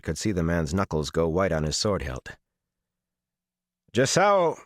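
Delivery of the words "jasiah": high, level, held-in command; flat voice. A narrator's reading. Commander calls to his man after bad news, flat voice. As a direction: call the name on one raised level tone. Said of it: "he said in a flat voice".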